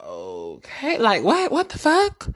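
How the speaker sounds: climbing into a shocked squeak; incredulous outrage. Retold or imitated. Reacting to rude message.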